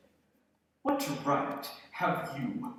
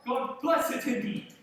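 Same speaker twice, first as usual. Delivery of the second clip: sarcastic sneer; 9 semitones higher than usual. Acted. Scrooge sneers at nephew's blessing.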